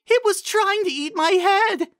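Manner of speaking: high, loud, panicked cry; a pony terrified of an unseen mouth; panicked exclaim. Acted. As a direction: scream it as you back away. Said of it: "Carrot Top exclaimed".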